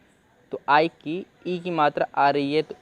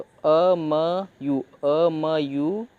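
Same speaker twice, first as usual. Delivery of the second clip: slow syllable demo; much slower than usual. Shown as a demonstration. Teacher breaking amused into syllables for students, very slowly. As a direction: sound out each syllable very slowly. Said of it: "तोड़ तोड़ के पढ़ लेते हैं".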